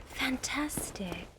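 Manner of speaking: slow dry whispered fantastic; sarcastic whisper. Real, not acted. Sarcastic after danger.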